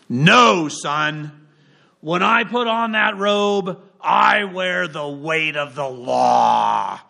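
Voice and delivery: slow, grave, weighty voice of the law; stern judge. Retold or imitated. Judge rebuking a student who asked for his robe, slow and grave. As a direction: lay down the law slowly to a cheeky boy. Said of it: "but that was the tone".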